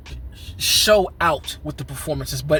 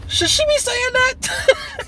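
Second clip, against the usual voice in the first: very high, drawn-out falsetto question; acted-out shock; slower than usual. Reviewer acting out his shock at an actress's lines, scandalized falsetto. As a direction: squeal it slowly in mock scandal.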